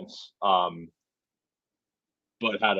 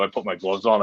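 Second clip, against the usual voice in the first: normal talking voice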